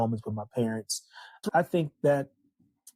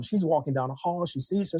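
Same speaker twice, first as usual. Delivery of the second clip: ordinary delivery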